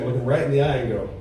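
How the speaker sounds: ordinary delivery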